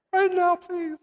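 put-on very high, squeaky child's plea; mock frightened plea. Fifth grader begging to be baptized after scary film strip, mock frightened. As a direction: beg in a tiny, high mock-panicked voice.

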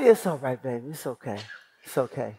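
mock-tender wife soothing her husband; tender hushing. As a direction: hush him like a crying child.

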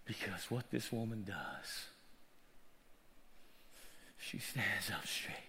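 very low, soft, near-whispered sermon; hushed preaching. Real, not acted. Preacher longing for his mama's healing, hushed and low.

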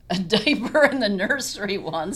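loud laughing story. Real, not acted. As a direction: laugh through the memory. Laughing over diaper story.

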